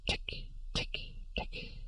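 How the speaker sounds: whispered tick tick tick clicks; clicking whisper. Acted. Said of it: "tapping its mouthparts together".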